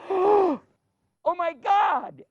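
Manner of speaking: drawn-out, high cry of astonishment; slow, stunned Oh my God; shocked gasp. Acted. Brother realising the king is Yusuf, high and astonished.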